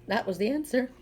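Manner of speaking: ordinary delivery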